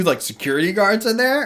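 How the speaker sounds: ordinary delivery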